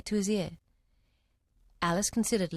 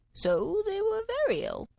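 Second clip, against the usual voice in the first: sleepy dormouse; 12 semitones higher than usual. A narrator's reading.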